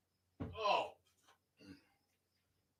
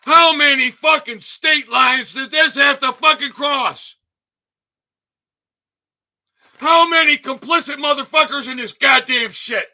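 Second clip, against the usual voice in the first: outraged shout; 4 semitones higher, 4 dB louder than usual. Real, not acted. Outraged at a label on a product.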